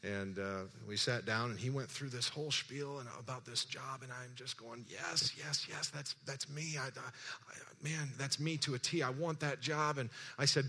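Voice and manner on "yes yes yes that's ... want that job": high-pitched, drawn-out yeses building to keen wanting; eager agreement. Retold or imitated. Job seeker hearing the dream job pitched, eagerly agreeing. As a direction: act out being sold on every word.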